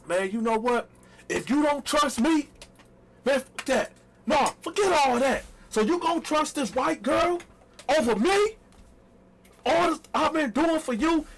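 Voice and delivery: high, hurt protest; mock outrage. To his girlfriend, a hammed-up wounded protest. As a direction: flip the blame back onto her. Turning the tables, acted outrage.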